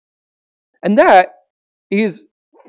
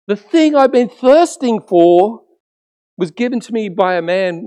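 defiant woman; 5 semitones higher, 3 dB louder, slower than usual. Retold or imitated. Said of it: "her response is".

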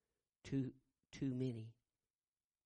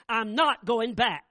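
loud, slow, final shout; angry refusal; 8 semitones higher, 16 dB louder, slower than usual. Retold or imitated. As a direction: shut it down for good. Roy refuses again angrily.

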